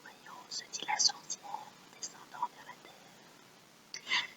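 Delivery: very faint, slow whisper; barely audible whisper, as if repeating along; whispered echo. Shown as a demonstration. Echoing the line for pupils.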